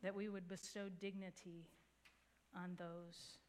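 calm, earnest prayer to God; closing prayer. Acted. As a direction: pray for the congregation, steady and sincere.

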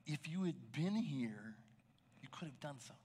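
Martha's words in a breathy hush; hushed reproach. Acted. Martha to Jesus, grieving, in a hushed reproach.

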